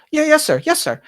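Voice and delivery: bow and report to the master politely; eager-to-please housemaid; timid maid Bonnie. Acted.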